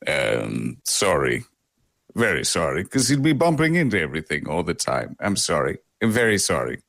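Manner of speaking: put-on low, slurred, sleepy drunk dragon apologizing. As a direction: mumble low like a tired drunk trying to stay awake. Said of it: "a drunk dragon in a bar".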